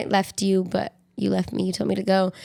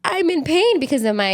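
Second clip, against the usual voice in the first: high, strained mock cry; half-hearted wail of pain; mock emotional; 9 semitones higher than usual. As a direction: voice jumps up playing hurt. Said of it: "I was like".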